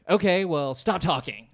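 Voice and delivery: mock-stern dismissal; antagonistic. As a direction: imitate a comic cutting off a heckler. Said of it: "this is very antagonistic".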